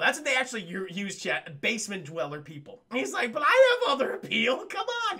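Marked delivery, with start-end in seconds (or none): 3.3-5.2 whiny plea. Retold or imitated. Daffy pleading with the executive, high whiny plea. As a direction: pitch shooting up on come on.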